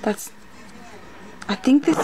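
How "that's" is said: ordinary delivery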